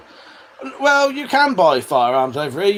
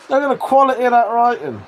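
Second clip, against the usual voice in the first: mock proud boast; 7 semitones higher, 4 dB louder than usual. Real, not acted. Mock-proud of his shaky writing.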